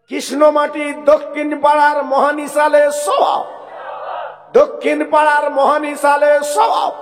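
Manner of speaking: slogan shout. Real, not acted. Preacher leads the crowd in a religious slogan, slow loud shout. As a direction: bellow each word so the crowd can echo it.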